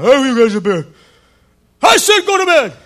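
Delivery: loud, stern dad yelling from another room; strong fatherly order. Retold or imitated. Dad ordering five boys to bed, strong holler. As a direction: imitate Dad hollering upstairs at the kids. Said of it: "can't say it that strong".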